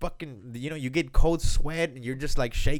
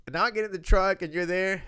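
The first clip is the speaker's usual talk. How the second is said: ordinary talk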